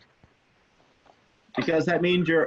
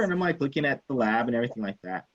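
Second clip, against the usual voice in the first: usual speaking voice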